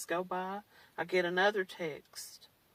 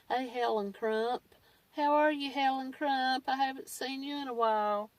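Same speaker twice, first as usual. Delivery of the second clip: mock fawning greeting; 6 semitones higher than usual. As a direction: ham up a fan gushing over her. Followers greeting her, mock fawning.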